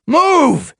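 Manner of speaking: angry leader shouting a single order; growl. A narrator's reading. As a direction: snap one furious word. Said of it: "he growled".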